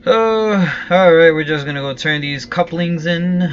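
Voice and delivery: loud, high wrap-up call; loud announcement. Real, not acted. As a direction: call it out to the chat. Streamer wraps up loudly.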